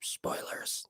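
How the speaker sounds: soft, breath-only whisper; whispered aside. Pretend. Streamer echoing the spoiler complaint to viewers, whispered. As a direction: whisper the word like a secret warning. Hushed repeat of the word.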